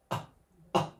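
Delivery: tiny, soft coughed "ah"; little cough-like pulse, held right back; miniature cough. Retold or imitated. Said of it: "he did a miniature one".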